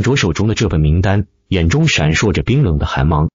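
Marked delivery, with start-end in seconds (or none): none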